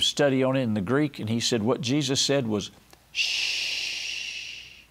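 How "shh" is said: a long, soft unvoiced shush, like soothing a baby. Shown as a demonstration. Jesus hushing the storm like a mother with a baby, soft shush. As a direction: hush it the way you settle a child to sleep. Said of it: "We do that to little children".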